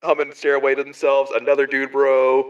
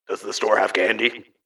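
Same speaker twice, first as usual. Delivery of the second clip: lower, lightly voiced twelve-year-old dwarf; dwarven scout kid; 4 semitones lower, 3 dB quieter than usual. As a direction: ask for candy as a dwarf kid whose voice has dropped. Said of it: "Is he going through dwarf puberty?".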